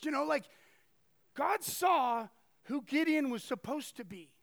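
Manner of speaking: ordinary talk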